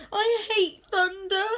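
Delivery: high, loud little wail; whimper. A narrator's reading. Monster leaps into Owl's arms at thunder, whimpering. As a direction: whimper it in fright. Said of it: "he whimpered".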